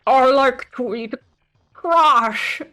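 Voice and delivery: goblin-ish sewer creature. Shown as a demonstration. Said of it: "I'm gonna try another line".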